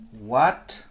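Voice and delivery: ordinary delivery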